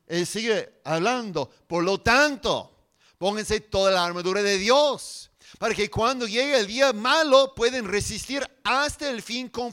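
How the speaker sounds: loud, high, forceful scripture preaching; prédica a plena voz. Acted. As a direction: proclaim the verses to rally the congregation. (proclamando la Escritura con fuerza)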